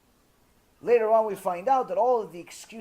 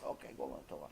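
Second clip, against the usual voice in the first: much lower, hushed reply; quiet acquiescence; 18 dB quieter, faster than usual. Retold or imitated. Wife sends husband off to learn, quiet acquiescence. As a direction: drop right down to a low, soft murmur. Acquiescing at end of acted argument.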